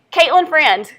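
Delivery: loud classroom name call; projected teacher's roll call. Real, not acted. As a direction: call the name out across the room.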